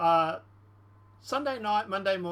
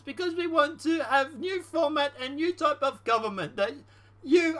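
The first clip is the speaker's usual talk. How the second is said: higher put-on voice, acted transcript reading; Ukrainian president role; 6 semitones higher than usual. Congratulated leader thanking the American president, as a higher-voiced Ukrainian president. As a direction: play the Ukrainian president, pitched up. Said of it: "The president from the Ukraine".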